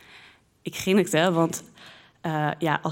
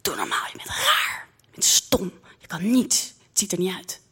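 whispered taunts. Retold or imitated. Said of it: "fluister ik haar toe".

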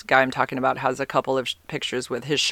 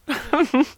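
high giggle; 12 semitones higher than usual. Real, not acted. Laughs at own word.

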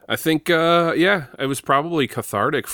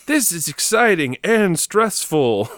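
mock excited fan; 9 semitones higher, 4 dB louder than usual. Host imitating fans watching a tense game, mock excitement. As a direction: draw it out high like an overwrought fan. Voicing fans' posts about volleyball.

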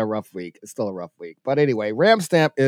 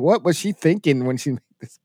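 ordinary talk

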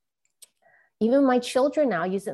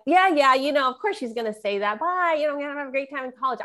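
higher-pitched, quick dismissive recalled reply; imitating her own airy "yeah, yeah"; breezy brush-off; 6 semitones higher, faster than usual. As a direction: toss it off like a carefree teen leaving.

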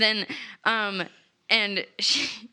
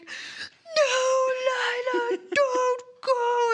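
hammed-up crying plea; 12 semitones higher, slower than usual. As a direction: overplay a tearful cry to stop her. Boudreaux begs Lila to stay.

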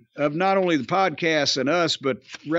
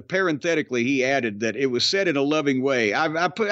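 ordinary talk, nothing marked

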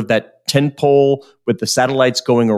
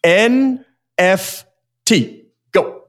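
each letter drawn out slowly, higher; slow dramatic announcement; 4 semitones higher, much slower than usual. Acted. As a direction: announce the letters like a game-show host. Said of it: "I'm gonna say three letters".

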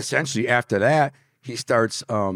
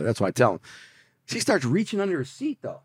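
ordinary talk, nothing marked

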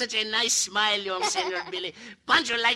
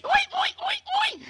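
high squealing pig oi. Acted. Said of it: "and the pig say".

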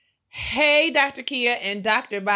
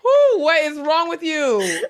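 high, slow, laughing whew; laughing exasperation; 10 semitones higher, 6 dB louder, slower than usual. Real, not acted. Laughing at the letter writer, high and slow. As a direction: gasp it out between laughs.

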